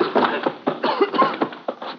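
sudden high strangled cough; choking cough. Acted. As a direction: gag as ashes hit the eyes. Ashes thrown in his eyes.

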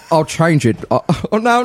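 normal talking voice

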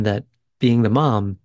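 usual speaking voice

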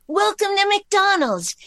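sing-song Welcome to McDonald's like a doll; Barbie doll voice. Acted. Reading Barbie's line on phone, high doll voice. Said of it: "I just read for Barbie's voice".

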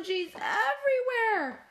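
high, wailing outburst; hammed-up high cry of dismay; distressed cry. A narrator's reading. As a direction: wail it in horror, stretching the word. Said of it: "cried Spoon".

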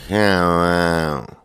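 imitating a country dude's long slow drawl; mimicking the friend's brother's drawl. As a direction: stretch it out lazy and slow. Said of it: "he had the slowest drawl".

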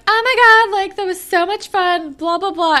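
high, loud giddy squeal; mock a starry-eyed girl; gushing Jenna. Jenna gushing to Sav, high and loud. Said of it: "she's like".

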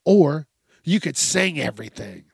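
normal talking voice

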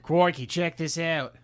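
slow mock Aussie presenter; Australian accent. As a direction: drawl it like a croc hunter.

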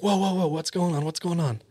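high-pitched startled reaction, not shouted; imitated alarm. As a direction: mimic men jolted awake in alarm. Retold men startled by screaming.

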